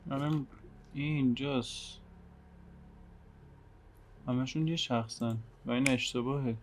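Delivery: ordinary delivery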